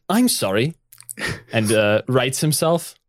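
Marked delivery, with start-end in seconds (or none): 0.1-0.7 high apologetic voice. Acted. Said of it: "And he goes".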